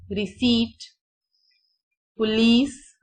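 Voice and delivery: रिसीट said risiit with silent p, पुलिस said pulis with rising end; slow model pronunciation for learners; careful word demo. Shown as a demonstration. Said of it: "यहाँ पर पी silent है".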